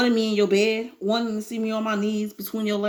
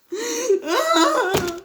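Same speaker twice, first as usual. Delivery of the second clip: higher laughing sob; laugh-cry; 10 semitones higher than usual. Real, not acted. Reader recovering from tears, laughing and crying. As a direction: let the laugh tip into crying. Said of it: "tears that I cried".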